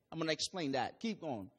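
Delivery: ordinary delivery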